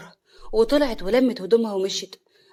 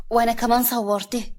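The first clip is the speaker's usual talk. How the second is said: plain reading voice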